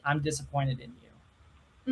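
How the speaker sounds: soft, slow, measured reproach; hushed voice in the back of their head; imitated disappointed domme. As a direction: imitate a disappointed scolding, quietly and slowly. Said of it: "hear her voice in the back of their head going".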